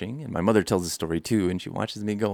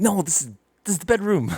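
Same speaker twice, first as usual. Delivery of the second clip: high, loud protest; acted dismay; 11 semitones higher, 6 dB louder than usual. Boy waking back in the bedroom, loud dismayed protest. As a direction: cry out in mock dismay.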